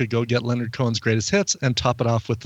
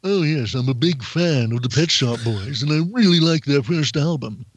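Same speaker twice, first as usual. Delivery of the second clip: hammed-up posh accent; mock-posh gentleman gushing; Mister Howell. Shown as a demonstration. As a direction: do a posh, upper-crust fan with swooping tune. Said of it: "make him sound posh like, uh, Mister Howell".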